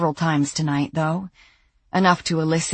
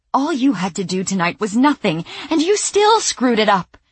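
fast, rising to a high furious pitch; angry scolding; 7 semitones higher, 4 dB louder than usual. Acted. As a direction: scold him, losing it. Sandy scolding him.

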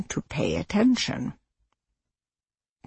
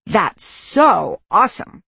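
gushing rote praise, higher pitched; mock-enthusiastic, insincere compliment; shallow insincere praise; 4 semitones higher, 4 dB louder than usual. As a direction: gush it like empty, automatic praise. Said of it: "can come across as shallow and insincere".

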